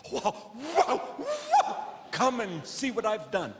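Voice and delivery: high, squealing puppy voice with a laugh; excited dog voice. Acted. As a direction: yelp it like a thrilled dog at the door. The dog greets its returning owner.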